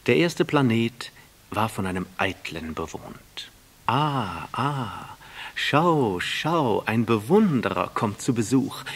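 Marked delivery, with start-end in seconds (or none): 3.9-8.8 loud, delighted vain man's call; calling from afar. A narrator's reading. The vain man calling out to the approaching prince, loud. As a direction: hail the visitor from a distance. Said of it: "rief der Eitle von weitem".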